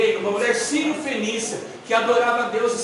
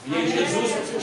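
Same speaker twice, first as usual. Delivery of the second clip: normal talking voice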